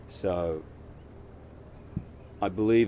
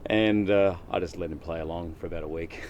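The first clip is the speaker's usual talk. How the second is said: ordinary talk, nothing marked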